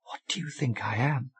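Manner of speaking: half-hiss the words at him; a touch of a hiss, quietly indignant; almost hissed. A narrator's reading. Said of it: "almost hissed".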